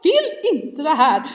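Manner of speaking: high, loud mock-desperate wail; crying out. Mamma calling on Jesus amid traffic chaos, re-enacted desperate cry. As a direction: wail a hammed-up prayer for rescue. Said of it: "kan jag också ropa på Jesus".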